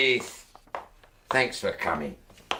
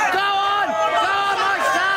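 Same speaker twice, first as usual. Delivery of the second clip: shouted cheer; 11 semitones higher, slower than usual. Real, not acted. Fan urging on a player, shouting high. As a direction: yell encouragement at the pitch.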